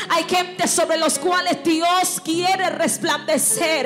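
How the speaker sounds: loud, high preacher's cry; impassioned revival sermon; fiery preaching. Real, not acted. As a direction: lift the church to its feet. Preaching at full force.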